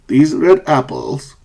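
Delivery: slow, "apples" stepping down; falling intonation. Shown as a demonstration. Teacher shows where the pitch drops, falling on "apples". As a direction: slowly let the pitch drop on "apples". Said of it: "apples falling".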